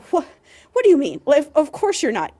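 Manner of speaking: loud, high incredulous questioning; exasperated disbelief. Acted. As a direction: react as if it's utterly absurd. Acted disbelief at virgin claim.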